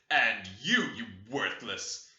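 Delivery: loud snap, then a slow drawn sneer; angry outburst. Acted. Heathcliff turning on his daughter-in-law, loud and scornful. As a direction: break out at her in scorn. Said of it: "he broke out".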